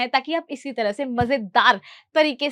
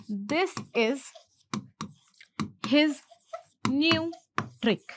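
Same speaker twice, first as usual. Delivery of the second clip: slow dictation with long pauses; word by word; 3 semitones higher, much slower than usual. Shown as a demonstration. Teacher translating the new trick line, word by word. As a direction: hand over the sentence a piece at a time.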